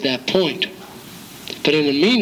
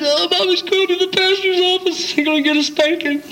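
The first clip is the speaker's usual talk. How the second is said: high, playful mock warning to a child; teasing mother; 12 semitones higher than usual. Mother teasing her crying boy about the pastor's office, high and playful. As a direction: tease the little boy, pitched way up. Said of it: "She said".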